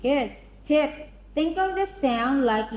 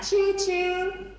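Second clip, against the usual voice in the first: high sing-song choo-choo like a train; 8 semitones higher than usual. Shown as a demonstration. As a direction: call out a train whistle. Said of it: "Say that".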